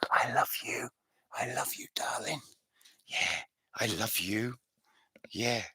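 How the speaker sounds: soft loving whisper into a dog's ear. Shown as a demonstration. Owner showing how they whisper to their dog, loving whisper. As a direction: whisper sweet nothings, hushed and tender. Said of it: "and I whisper in her ear. This is what I do".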